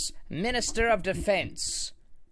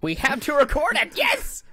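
high, louder, eager cry; an official who can't wait to film his victory, high and loud; excited mock official; 10 semitones higher, 5 dB louder than usual. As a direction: play the keen minister cheering for the cameras. Voicing eager minister.

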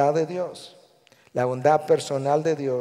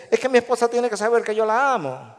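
higher-pitched, emphatic protest; insistent husband; 7 semitones higher, 4 dB louder than usual. Acted. Husband to pastor in counseling, insistently. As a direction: play a husband insisting on his love. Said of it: "un esposo dice".

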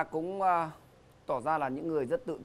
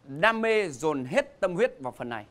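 game show host; 6 semitones higher, 5 dB louder than usual. Acted. Announces round three.

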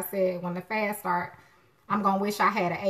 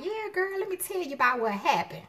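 put-on gossipy girlfriend voice; 9 semitones higher than usual. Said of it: "people talking like".

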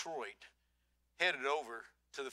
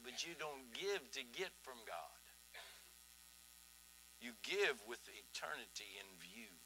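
hushed; 3 semitones lower, 13 dB quieter than usual. Real, not acted. Preacher softly driving home the point to the congregation. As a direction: bring it down to a quiet, earnest close. Quiet close after the loud build.

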